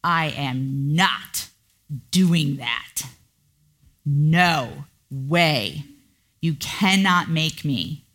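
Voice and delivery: slow, defiant inner refusal; stubborn refusal. Retold or imitated. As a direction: dig your heels in, slowly and firmly.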